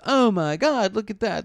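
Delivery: high, showy fake excitement; put-on starstruck squeal in words; mock gushing. [name] distracts the bouncer by the VIP door, high mock gushing.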